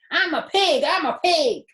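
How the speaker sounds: high loud silly chant; silly boy. A narrator's reading. Avery crawling in the crate, loud and silly. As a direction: play the pig like a rowdy boy.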